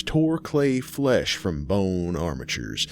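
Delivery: ordinary delivery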